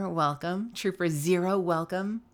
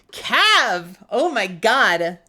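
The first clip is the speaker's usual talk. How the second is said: loud, drawn-out astonishment; amazed outcry; 3 semitones higher, 7 dB louder, much slower than usual. Real, not acted. Streamer reacting to a year of support, amazed. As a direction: cry out slowly in happy disbelief.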